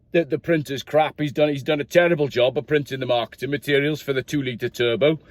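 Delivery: lightly voiced Welsh manager with a marketing problem; Welsh accent. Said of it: "I'll do it in Welsh instead".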